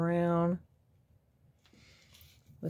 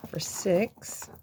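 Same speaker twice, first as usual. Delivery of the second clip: whisper. Real, not acted. Whispered count.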